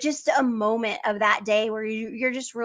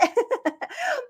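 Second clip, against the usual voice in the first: high laugh; 12 semitones higher than usual. Real, not acted. Laughing at her childhood self.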